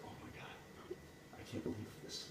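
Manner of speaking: acted near-whisper of disbelief; hushed dismay. Queen sees the goblin walk in, hushed dismay. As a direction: drop to a soft, stunned undertone.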